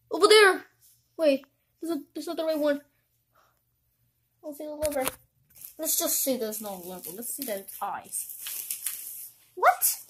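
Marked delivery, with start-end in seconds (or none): 5.8-9.3 low, slow, drawn-out menace; creepy villain. Acted. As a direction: drag the words out like a horror villain. Villain taunts, slows ominously.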